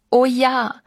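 ordinary delivery

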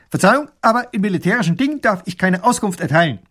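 high, loud soldier's report; rasping military bark. A narrator's reading. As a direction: rattle it off like a soldier at attention. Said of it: "schlägt die Hacken zusammen und schnarrt".

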